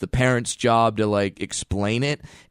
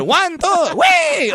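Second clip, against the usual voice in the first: high, loud heave-ho count; very high, loud throwing cry; counting shout; 5 dB louder, much slower than usual. Retold or imitated. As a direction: yell the count as you fling.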